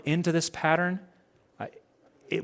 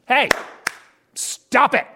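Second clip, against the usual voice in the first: mock stern scold; 10 semitones higher, 7 dB louder, slower than usual. Mocking scold.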